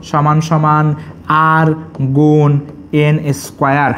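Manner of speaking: slow, word by word; teacher dictating formulas, slow; slow dictation. Shown as a demonstration. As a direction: dictate each term for copying.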